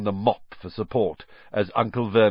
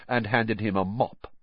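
ordinary delivery, nothing marked

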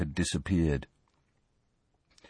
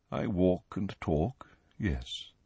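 quiet; 3 semitones lower, 8 dB quieter, much slower than usual. Acted. Nick answers quietly.

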